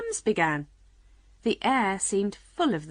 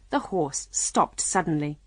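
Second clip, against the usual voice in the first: ordinary delivery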